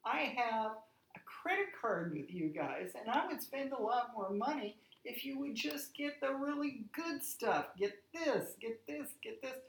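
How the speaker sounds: worked-up, emphatic imitation of complaining; retold complaint. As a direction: re-enact telling off a store manager. Re-enacting complaint to store manager.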